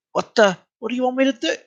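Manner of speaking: high, fast mock yell; exasperated rush, voice shooting up. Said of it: "I start yelling".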